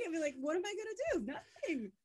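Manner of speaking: high-pitched, what-can-you-do protest; comic helplessness, pitched well up; helpless exasperation. Retold or imitated. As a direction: throw up the question in a high voice. Retells helpless giving up.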